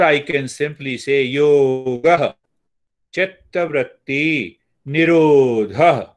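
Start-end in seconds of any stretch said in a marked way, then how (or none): 3.1-6.1 slow "nirodha" with a stressed final "ha"; emphasis on ha. Shown as a demonstration. Teacher stresses the final ha for students, slow chant. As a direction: lean on the last syllable of "nirodha". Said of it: "Emphasis on ha".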